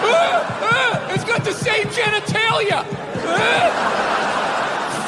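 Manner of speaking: high squealing mock delight; mock excited cheerer. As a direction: squeal like a giddy fan.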